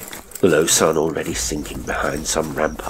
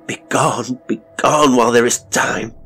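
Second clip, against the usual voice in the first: loud, high, urgent cry of begone; panicked command; 7 semitones higher, 6 dB louder than usual. A narrator's reading. Narrator voicing his mind's frantic order to flee. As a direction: shout it like your own terrified mind.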